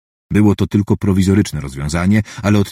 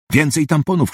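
mild cry; 7 semitones higher than usual. A narrator's reading. Surgeon calling for more tampons mid-operation, a mild urgent cry. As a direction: call out an order without really shouting. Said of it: "krzyknęła Katrin".

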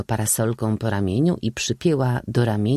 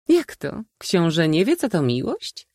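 high-pitched, teasing disbelief; amused old maid; 9 semitones higher than usual. A narrator's reading. Old maid teasing the prince about love, amused disbelief. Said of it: "Zaśmiała się stara panna".

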